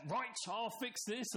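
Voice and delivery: high, slow mock-confident dad; hammed-up classic dad; bossy dad. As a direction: play the know-it-all dad taking charge. Dad storming ahead, lost.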